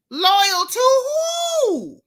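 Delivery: loud, high incredulous challenge; incredulous outburst. Real, not acted. Commentator answering a post about loyalty, loudly incredulous. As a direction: shout it back in high disbelief.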